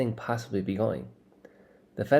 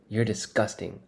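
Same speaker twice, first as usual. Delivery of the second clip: ordinary delivery, nothing marked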